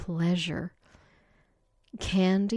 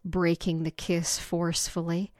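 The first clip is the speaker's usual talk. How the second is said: usual speaking voice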